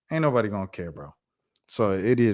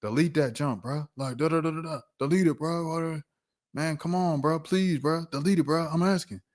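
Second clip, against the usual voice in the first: mock pleading; 4 semitones higher, 4 dB quieter than usual. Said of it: "A little worked up is like".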